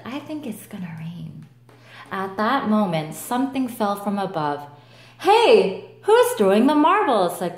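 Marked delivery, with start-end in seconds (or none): 0.0-1.5 soft unvoiced whisper. Ant whispering. 5.2-7.4 higher, louder protest; indignant shout. Acted. Cockroach protesting.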